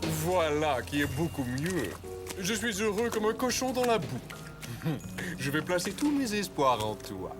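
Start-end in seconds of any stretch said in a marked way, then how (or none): 4.2-5.3 evil chuckle. Acted. Villain laughs at own scheme.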